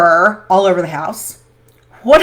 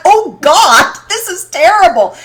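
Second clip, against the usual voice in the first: horrified; 8 semitones higher, 7 dB louder than usual. Retold or imitated. Horrified reaction retold.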